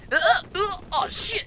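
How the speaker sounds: high panicked yelp; startled cry. Acted. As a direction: yelp as you fall. Junpei falls as ship shakes.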